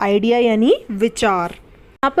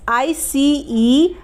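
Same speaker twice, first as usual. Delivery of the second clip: slow spelling; much slower than usual. Shown as a demonstration. Teacher spelling the word for students, very slow and deliberate. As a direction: spell out आई सी ई very slowly, letter by letter. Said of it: "आइस".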